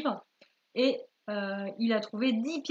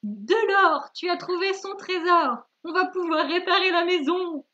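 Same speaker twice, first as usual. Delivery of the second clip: loud, high-pitched acted jubilation; theatrical, raised-voice delight; overjoyed; 6 semitones higher, 5 dB louder than usual. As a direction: cry out as a grandmother finding treasure. Grandmother discovers gold in story.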